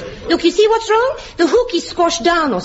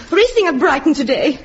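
ordinary delivery

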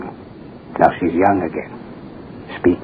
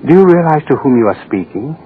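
higher, louder haughty rebuke; indignant; 6 semitones higher, 4 dB louder than usual. Acted. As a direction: put her in her place. Official rebukes doctor.

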